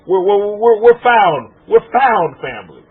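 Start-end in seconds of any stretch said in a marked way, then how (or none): none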